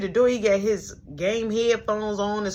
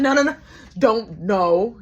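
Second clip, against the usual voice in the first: loud warning; 8 dB louder, slower than usual. Retold or imitated. Stopping a fight.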